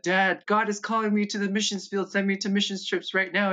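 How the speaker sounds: put-on high, pleading kid voice; eager younger self. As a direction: beg dad like an excited teen. Begging dad to send him.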